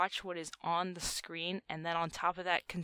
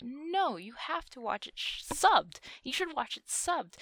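insistent; 6 semitones higher, 3 dB louder, slower than usual. Retold or imitated. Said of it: "I was, like, so insistent on that".

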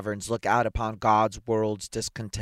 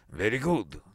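stern. A narrator's reading. Superintendent hearing the complaint, stern. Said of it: "said Auchmilov sternly".

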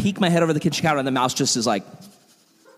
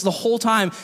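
normal talking voice

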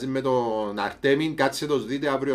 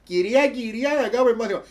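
pleading pupil voice; 10 semitones higher, 4 dB louder than usual. Retold or imitated. Kids begging teacher, pupils voiced.

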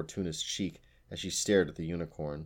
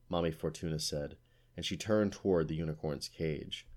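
ordinary delivery

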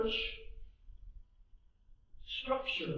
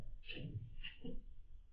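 soft breathy laugh; 9 dB quieter than usual. Real, not acted. Laughs at own denial.

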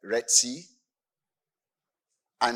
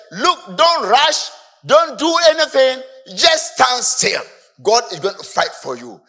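urgent command; 9 semitones higher, 8 dB louder than usual. Acted. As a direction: hold them back firmly. Moses urging the people calmly.

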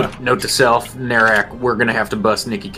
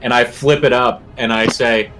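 ordinary delivery

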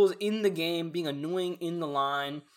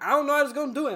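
loud, high-pitched mock protest; raised-voice comeback with no argument behind it; flustered classmate; 11 semitones higher, 7 dB louder, much faster than usual. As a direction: imitate a flustered girl, pitched way up. Flustered girl quoted in an argument.

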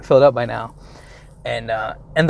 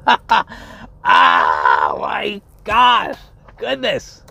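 exasperated outburst; 2 semitones lower, much slower than usual. Real, not acted. Venting exasperation after the story.

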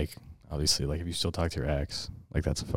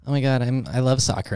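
put-on high, loud excited girl voice; gushing girl; 7 semitones higher, 6 dB louder than usual. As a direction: gush like an overeager fan. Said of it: "a girl that's like".

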